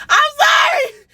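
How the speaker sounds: high, slow, laughing squeal; laughing sorry, loud and high; laughing apology. Real, not acted.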